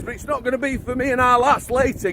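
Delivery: ordinary delivery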